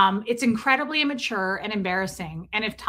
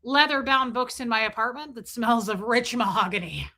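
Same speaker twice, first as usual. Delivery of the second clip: Ron Burgundy boast; 3 semitones higher, 4 dB louder than usual. Retold or imitated. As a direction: savour every word grandly. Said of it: "he's like".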